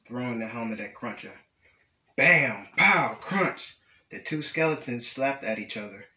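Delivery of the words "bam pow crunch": loud, high comic-book exclamations; punchy fight sounds. A narrator's reading. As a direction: hit each word like a blow. Fight sound words in story.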